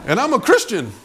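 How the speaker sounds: high-pitched, indignant acted protest; comically aggrieved, half-laughing protest; upset complaint. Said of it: "I was kind of upset".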